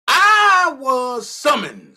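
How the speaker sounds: slow, loud mock-solemn line; dramatic slow proclamation. Streamer teasing viewers with a slow loud reveal. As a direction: drag it out like a big reveal.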